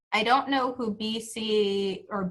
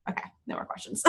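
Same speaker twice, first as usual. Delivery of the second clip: hoarse whisper; 13 dB quieter, much faster than usual. Real, not acted. Hoarse aside, voice going.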